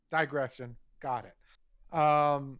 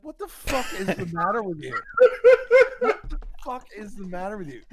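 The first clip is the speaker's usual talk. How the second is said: exasperated yell; 7 semitones higher, 13 dB louder than usual. Retold or imitated. Retelling yelling at Kevin.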